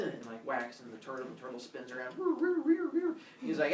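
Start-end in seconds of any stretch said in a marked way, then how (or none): 2.2-3.2 high-pitched whirling noise, repeated; cartoon spin noise; spinning sound effect. Imitates the turtle spinning around.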